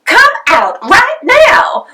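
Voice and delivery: put-on mother ordering her child out of the mud, slow and loud; stern order. A narrator's reading. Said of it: "ordered Missus Potter".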